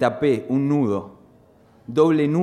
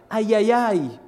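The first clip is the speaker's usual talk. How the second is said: wailing cry; 9 semitones higher than usual. Retold or imitated. Demented grandfather crying out in delirium, high wail. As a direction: cry out like the raving old man.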